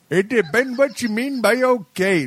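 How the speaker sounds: put-on high-pitched, muddled foreign accent; wildly off, high mock Russian accent; failed Russian accent. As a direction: attempt Russian, land somewhere else entirely. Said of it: "No, that's not Russian".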